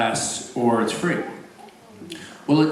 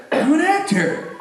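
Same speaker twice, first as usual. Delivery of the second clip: smug neighbor. Retold or imitated. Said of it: "he'd go".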